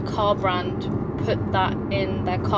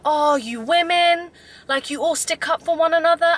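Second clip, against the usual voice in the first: higher, louder imitation of a complaining man; hammed-up exasperated retelling; mock exasperation; 7 semitones higher, 5 dB louder than usual. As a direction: act out his scornful complaint, pitched up. Retold man's complaint about women.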